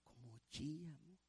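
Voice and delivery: very soft private muttering; hushed complaint. Acted. Deacon complaining about a weeping brother, hushed grumble.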